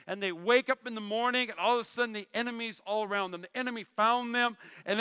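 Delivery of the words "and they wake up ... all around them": ordinary talk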